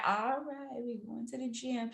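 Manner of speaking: soft, flat reluctant sigh; unmotivated. Retold or imitated. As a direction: drag it out, no energy. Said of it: "days where it just be like".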